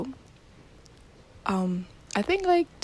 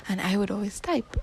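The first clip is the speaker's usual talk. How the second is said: usual speaking voice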